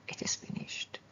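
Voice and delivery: awed whisper of the dying cry; hushed whisper. Retold or imitated. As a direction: whisper "It is finished" almost silently. Quoting Jesus' last cry.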